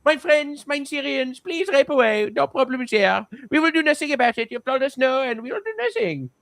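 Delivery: high, grovelling put-on German voice; hammed-up German pushover, eager to appease; servile mock Germans. Germans giving in to Syria's refusal, high servile mock voice. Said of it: "And they're like".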